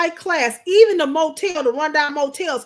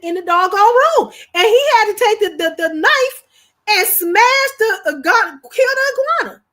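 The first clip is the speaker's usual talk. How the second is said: loud, high, building excitement; excited retelling; 8 semitones higher, 8 dB louder than usual. Real, not acted. Wife telling viewers about the iguana kill, loud and excited. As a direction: shout the climax of the story.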